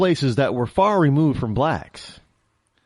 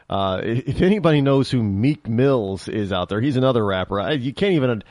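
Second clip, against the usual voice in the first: normal talking voice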